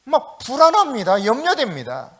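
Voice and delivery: ordinary talk, nothing marked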